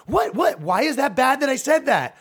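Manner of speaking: high, loud mock-innocent girlfriend; innocent protest. Girlfriend protesting she did nothing wrong, high loud voice. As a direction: play the clueless girlfriend.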